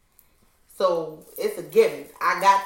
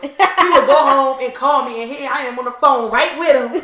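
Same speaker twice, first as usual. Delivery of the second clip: loud laughing chatter; 4 semitones higher, 12 dB louder than usual. Real, not acted. Telling friends about him, laughing loudly. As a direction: laugh through the words, much louder.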